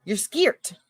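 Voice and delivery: "scared" as skeert, with an ee vowel; slang pronunciation. Shown as a demonstration. Host answering with the slang version, "scared" as skeert. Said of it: "we say skied".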